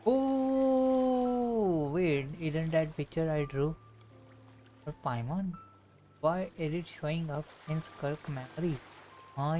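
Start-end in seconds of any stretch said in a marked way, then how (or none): none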